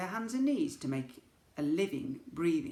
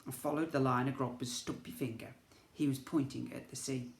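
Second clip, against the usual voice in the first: ordinary delivery, nothing marked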